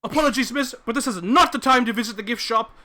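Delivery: curt loud brush-off. Acted. Man dismisses visitor at door.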